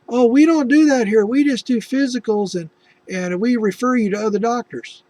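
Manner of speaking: high, loud brush-off; dismissive receptionist. Retold or imitated. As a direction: wave them away politely. Office refusing to treat.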